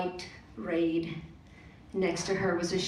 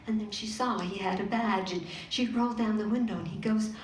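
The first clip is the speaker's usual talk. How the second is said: usual speaking voice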